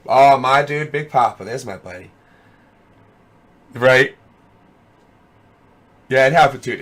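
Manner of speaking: ordinary delivery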